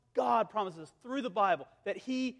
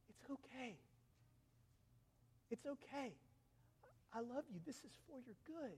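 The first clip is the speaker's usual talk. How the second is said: put-on very soft, tender reassurance to a crying child; hushed comfort; 23 dB quieter than usual. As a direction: soothe the frightened child almost under your breath. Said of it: "and he says".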